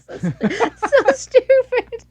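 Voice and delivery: helpless giggling, high; laughing hard. Real, not acted. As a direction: squeal through the laughter. Giggling at meme.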